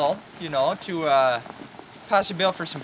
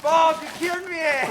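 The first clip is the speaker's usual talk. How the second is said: high, loud mock complaint; hammed-up outcry; 10 semitones higher, 7 dB louder than usual. [name] after crashing the boat, high and loud. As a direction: wail it up high like a goofy victim.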